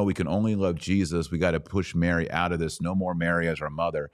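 ordinary talk

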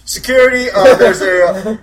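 loud, high-pitched blurt; sudden blurted shout. Acted. Orc's mouth suddenly talks by itself, loud blurt. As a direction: call it out loud as if it escaped you. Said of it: "out of my mouth says".

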